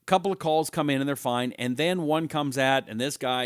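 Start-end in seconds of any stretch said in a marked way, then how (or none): none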